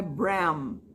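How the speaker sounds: Bram ending on a closed, hummed M; articulated final M. Shown as a demonstration. Teacher showing students how to close the M of Bram. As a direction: close the lips firmly on the M of Bram. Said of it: "mas articule o M".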